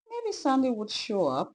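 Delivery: usual speaking voice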